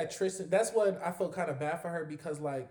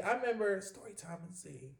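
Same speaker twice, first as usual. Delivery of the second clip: normal talking voice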